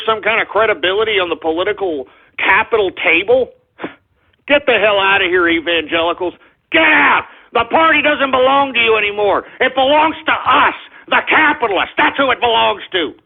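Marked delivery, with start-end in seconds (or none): 4.5-12.3 angry shout. Real, not acted. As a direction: bark them out of the party. Angry rant telling evangelicals off.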